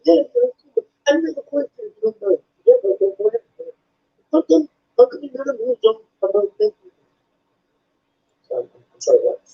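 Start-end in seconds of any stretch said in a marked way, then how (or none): 0.0-6.7 much higher, slurred imitation of a deaf fan's speech; imitated deaf fan. Fan praising the comedian's stuff, high slurred imitation. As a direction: gush like an eager fan who talks fast. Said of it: "He said".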